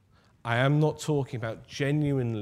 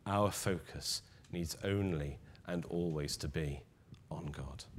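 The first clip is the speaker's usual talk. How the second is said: dropping to a soft near-whisper; hushed repeat; 8 semitones lower, 11 dB quieter than usual. Shown as a demonstration. Preacher repeating to the congregation, hushed, almost whispering. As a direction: let it fade into a whisper on God. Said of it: "said twice, second softer".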